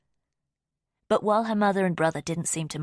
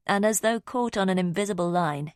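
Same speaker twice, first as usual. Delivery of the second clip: usual speaking voice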